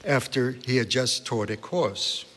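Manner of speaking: usual speaking voice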